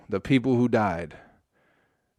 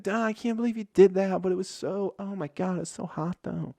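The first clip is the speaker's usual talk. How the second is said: high voice fading to a soft swoon; mock-swooning hen, high and breathless; 8 semitones higher, 4 dB quieter than usual. Hen swooning over winning rooster, high voice fading soft. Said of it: "now she's like".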